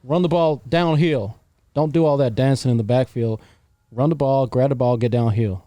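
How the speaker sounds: Southern football coach accent, lightly put on; mock Southern coach barking plays. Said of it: "Let me hear some Southern".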